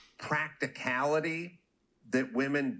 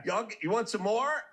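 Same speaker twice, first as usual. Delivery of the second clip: higher mocking bird, lightly voiced; taunting bird voice; 8 semitones higher than usual. Crane mocking the scared tigers, higher taunting voice. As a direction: needle them, pitched up and smug. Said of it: "the bird's like".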